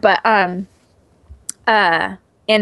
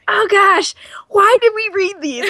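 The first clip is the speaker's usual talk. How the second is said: high-pitched exasperated groaning reaction; mock dismay; 11 semitones higher than usual. Friend recalling bad Twilight readings, in high mock dismay.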